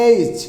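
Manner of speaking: "এইচ" said firmly with a hard ch close; hard pronunciation. Shown as a demonstration. Teacher drills the letter H, firmly pronounced "এইচ". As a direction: punch out "এইচ", firm final consonant. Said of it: "শক্ত করে উচ্চারণ করতে হবে".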